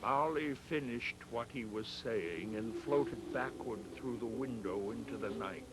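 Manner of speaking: ordinary delivery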